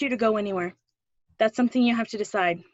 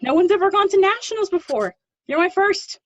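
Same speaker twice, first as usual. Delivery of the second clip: excited pride; 8 semitones higher, 8 dB louder than usual. Acted. Proud teacher cheering her student.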